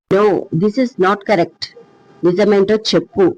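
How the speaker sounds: usual speaking voice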